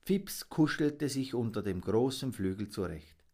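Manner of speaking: plain reading voice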